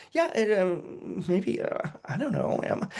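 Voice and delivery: soft, halting, unsure half-whisper; unsure mumble. Retold or imitated. Doubting self mumbles an unsure half-whispered answer. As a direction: trail off as if doubting yourself.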